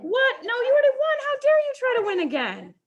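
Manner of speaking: high, loud mock indignation; mock outrage. Teacher hams up outrage at a student who won twice. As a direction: play up fake shock at a repeat win.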